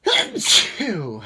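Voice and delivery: loud real sneeze; big explosive sneeze; sneezing. Real, not acted. Sneezing fit continues.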